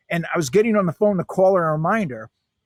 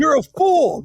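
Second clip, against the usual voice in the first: high, loud mock scold; 10 semitones higher, 5 dB louder, slower than usual. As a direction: snap it out like calling someone a fool. Mock scolding the all-knowing boss.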